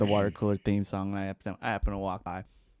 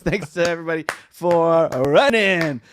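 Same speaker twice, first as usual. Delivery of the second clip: louder, slower announcer read; 5 semitones higher, 6 dB louder, slower than usual. Acted. Host introducing the food segment, lightly announcer-style. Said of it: "host announcing segment".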